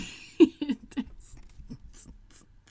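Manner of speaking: long, breathless burst of laughter; real helpless laugh. Real, not acted. Streamer cracking up at a viewer's comment, helpless laugh. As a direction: crack up until the breath runs out. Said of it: "You know why I'm laughing?".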